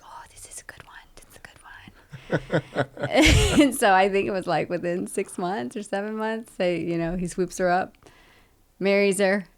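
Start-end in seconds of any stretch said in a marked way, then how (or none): 0.0-3.6 conspiratorial giggly whisper, slow; whispering sisters. Retold or imitated. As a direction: hush it like sisters gossiping with a giggle. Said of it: "some of the sisters were like".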